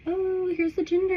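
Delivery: high-pitched pretend announcement; mock reveal cheer. Imagined gender reveal announcement.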